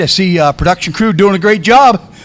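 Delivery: ordinary talk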